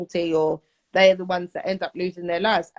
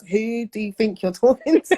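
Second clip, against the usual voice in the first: mock-indignant telling-off, slightly raised pitch; acted indignant challenge; 3 semitones higher than usual. Youth worker voicing what she wanted to say to girls, indignantly. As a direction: act out the unprofessional retort held back. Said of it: "I wanted to say to these girls".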